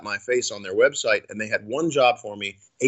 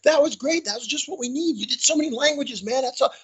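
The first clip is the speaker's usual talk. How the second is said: much higher, gushing praise; excited cruise director; 13 semitones higher than usual. Retold or imitated. Cruise director raving to John backstage, high and excited. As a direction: rave like he wants to hug you. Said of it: "He's like".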